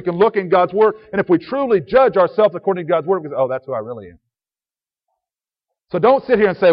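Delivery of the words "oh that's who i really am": lower, softer humbled admission; quiet self-realization. Acted. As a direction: drop low and soft, owning up to it. Seeing oneself in God's Word.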